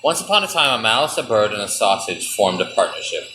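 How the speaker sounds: low, measured fairy-tale reading; story narration. A narrator's reading. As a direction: read it like a bedtime story.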